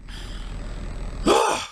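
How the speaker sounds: long weary sigh out; exasperated sigh. Real, not acted. Reviewer sighing at Twilight's choice, weary. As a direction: let the breath go, fed up.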